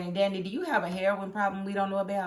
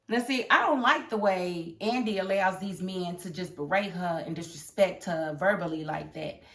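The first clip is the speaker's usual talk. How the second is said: ordinary talk, nothing marked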